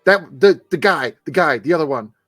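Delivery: high, loud, stammering panic; scrambling shrill for a name he can't recall; flustered thug shouting over himself; frantic Guzman. Acted.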